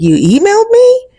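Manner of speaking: surprised. Retold or imitated. He's surprised by the email.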